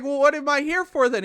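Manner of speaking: loud, high-pitched retold protest; comic exasperation. Lookout protesting to his buddy that he's useless, loudly exasperated.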